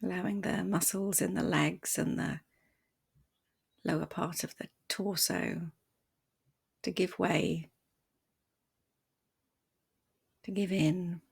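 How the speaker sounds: soft, unhurried meditation voice with long pauses; guided meditation. Acted. Meditation teacher guiding listeners to settle the body, softly and calmly. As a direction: ease them gently into stillness, leaving space between phrases.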